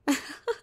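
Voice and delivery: high burst of amused laughter; derisive laugh. Acted. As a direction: laugh at a noble offer. Vampire amused by hunter's offer.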